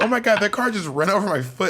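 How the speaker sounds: put-on astonished kid protesting to mom; retold astonishment. Retold startled childhood outburst.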